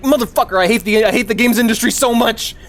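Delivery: high, loud mock rage; angry outburst. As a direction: blow up at the games industry. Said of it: "whenever I get angry".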